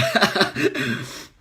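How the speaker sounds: loud laugh at the time; burst of real laughter. Real, not acted. Laughing at how late her rant ran. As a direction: laugh it off, caught out by the clock.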